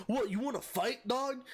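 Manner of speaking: high-pitched mock tough guy; drunken challenge. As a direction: imitate a drunk daring someone to fight. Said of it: "He fucking lifts me up".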